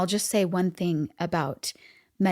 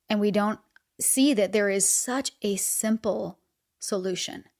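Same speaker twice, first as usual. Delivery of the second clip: ordinary talk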